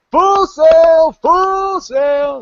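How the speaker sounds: very high, loud sailor's call; long-held holler passed along; relayed shout. Acted. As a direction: echo the order down the line. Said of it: "it's just going down the line".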